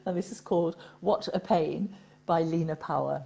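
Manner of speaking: ordinary talk, nothing marked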